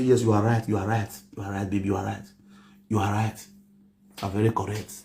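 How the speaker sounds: soft, low, meek yes-saying to the wife; meek agreement. Retold or imitated. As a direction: give in softly and humbly. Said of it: "I go say".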